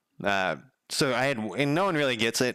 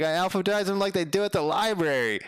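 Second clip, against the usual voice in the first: eager proud; 8 semitones higher than usual. Retold or imitated. Speaker explaining proudly to guy.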